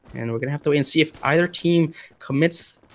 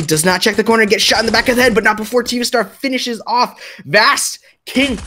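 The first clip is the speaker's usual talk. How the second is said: higher, louder kill call; excited play-by-play; 6 semitones higher, 3 dB louder than usual. Real, not acted. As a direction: build excitement as the kill lands.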